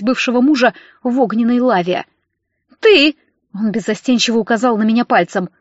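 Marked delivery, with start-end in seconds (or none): 2.8-3.2 high, loud jab of a word; sharp angry outburst; accusing. A narrator's reading. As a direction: fling it out like a pointed finger. Drunk husband accusing, pointing.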